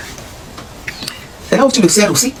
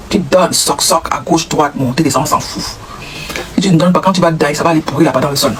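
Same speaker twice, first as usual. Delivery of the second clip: ordinary talk, nothing marked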